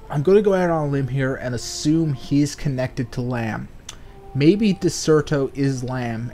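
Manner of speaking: ordinary talk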